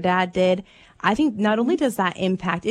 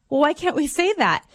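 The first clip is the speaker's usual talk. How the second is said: put-on higher puzzled kid voice; a child genuinely confused by the rule; innocent little girl; 7 semitones higher than usual. Mom voicing her daughter asking why not.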